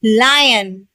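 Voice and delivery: measured teacher's model of लायन, "LAI-yun"; correct lion pronunciation. Shown as a demonstration. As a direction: model लायन with an "ai" straight after L. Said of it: "सही pronunciation क्या है?".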